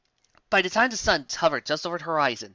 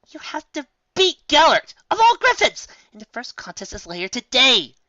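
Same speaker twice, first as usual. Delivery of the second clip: insistent; 11 semitones higher, 8 dB louder than usual. Acted. Friend urging Star Swirl, loud and pressing. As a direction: drive home the warning.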